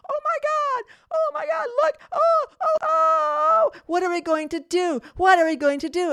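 mock-shriek like terrified executives watching disaster; panicked clients. Clients watching the tiger wreck furniture, shrieking in panic. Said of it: "they're freaking out because".

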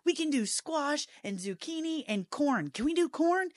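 high, eager run of ideas; excited retelling. Retold or imitated. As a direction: list each one with rising excitement. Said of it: "He was so excited".

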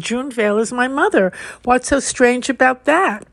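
usual speaking voice

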